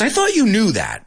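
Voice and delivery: a high, lightly acted character line; acted movie line. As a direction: voice the brother's retort. Teacher acts film dialogue.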